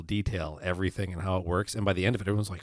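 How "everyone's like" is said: usual speaking voice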